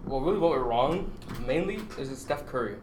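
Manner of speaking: ordinary delivery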